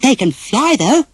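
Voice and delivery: a proud little grin in the voice; Dumbo smiling. Acted. Dumbo tells the boys he can fly, high and smiling. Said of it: "said Dumbo, smiling".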